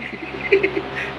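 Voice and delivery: breathy quiet chuckle; soft laugh. Real, not acted. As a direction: laugh under her breath. Laughs after greeting Guylaine. (petit rire étouffé)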